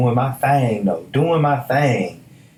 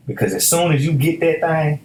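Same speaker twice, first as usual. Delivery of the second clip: ordinary talk, nothing marked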